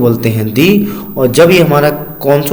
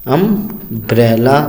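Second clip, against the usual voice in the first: slow spelled-out word; 3 semitones lower, 3 dB quieter, much slower than usual. Shown as a demonstration. Teacher sounding out a word for children, slow. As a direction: say umbrella slowly and softly, syllable by syllable. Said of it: "umbrella Hindi में कैसे लिखा जाएगा?".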